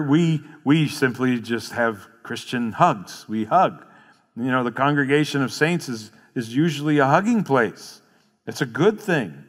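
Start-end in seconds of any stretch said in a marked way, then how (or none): none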